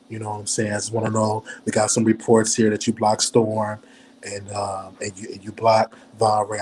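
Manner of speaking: low, put-on deep voice; real deep voice. Shown as a demonstration. Phoning Tasha about who she blocked, in a deep voice. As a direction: drop into your deep voice for questioning. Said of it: "Put on my real deep voice".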